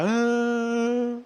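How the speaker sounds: put-on high sobbing child's "ah"; imitated child's wail. Preacher mimicking his son bursting into a high wail. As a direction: mimic a little boy starting to cry. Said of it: "Daqui a pouco começou a chorar".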